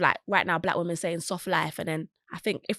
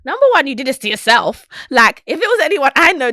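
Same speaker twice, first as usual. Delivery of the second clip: loud, higher, indignant rant; indignant outburst; 6 semitones higher, 13 dB louder than usual. Real, not acted. Reacting to the crying vlogger, loud and indignant. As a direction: blast it out in disbelief.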